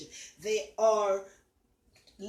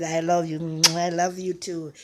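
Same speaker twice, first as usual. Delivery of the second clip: louder, heartfelt reply of love; loud answering I love you; 5 semitones lower, 7 dB louder than usual. Retold or imitated. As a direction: call it back warmly and fully. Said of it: "She says, she said".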